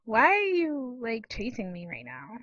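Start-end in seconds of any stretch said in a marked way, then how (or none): none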